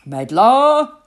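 captain hollering for the cook below; shout. A narrator's reading. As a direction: yell down to the galley. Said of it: "shouted Captain Pike".

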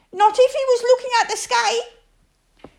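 small, high, squeaky little piglet voice. Acted. Narrator voicing Piglet fretting about the trap, high and squeaky. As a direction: squeak it out high like a tiny worried animal.

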